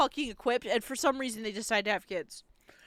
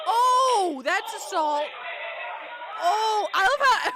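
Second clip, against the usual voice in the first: loud, high, laughing disbelief; half-laughing outrage; shocked outburst; 9 semitones higher, 7 dB louder, much slower than usual. Real, not acted. Outraged at brawl footage.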